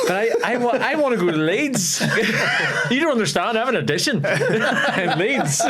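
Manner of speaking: high-pitched mock pleading through laughter; laughing protest. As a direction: plead your case, cracking up as you retell it. Retold protest at diverted flight, laughing.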